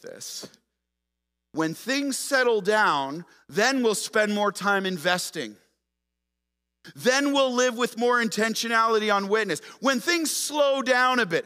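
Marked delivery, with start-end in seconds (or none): 1.5-11.5 louder, higher, slow mocking echo of a lame excuse; mocking loud quote. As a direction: build it up into a sarcastic near-shout. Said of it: "we tend to say".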